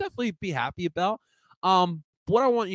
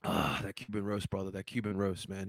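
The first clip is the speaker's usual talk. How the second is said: low savouring murmur; 7 semitones lower, 11 dB quieter than usual. Real, not acted. Praising Cuban roast to chat, low and soft. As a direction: lower and softer, relishing.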